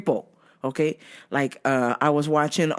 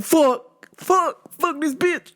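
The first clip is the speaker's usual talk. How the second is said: high, separated curses of dismay; mock panic; 9 semitones higher, much slower than usual. Worker stuck with the stalker, mock-panicked cursing. Acts panicked inner reaction.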